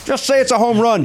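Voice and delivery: high-pitched heckle; hammed-up fed-up fan; mock exasperated plea. Host scolds the announcer for his catchphrases, mock exasperated.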